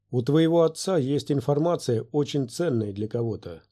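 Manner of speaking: usual speaking voice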